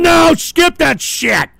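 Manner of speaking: high, slow, drawn-out yell; urgent shout. Real, not acted. Host demanding the clip be skipped, loud urgent shout. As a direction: yell it over the clip, stretching the words.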